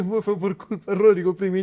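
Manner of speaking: teasing words said through real laughter; laughing. Real, not acted. Host laughing as he teases the guest about Rodrigo Brimni. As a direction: laugh it out while ribbing her.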